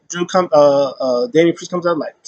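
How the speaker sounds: normal talking voice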